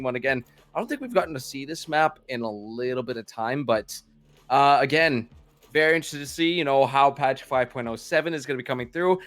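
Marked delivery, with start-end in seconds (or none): none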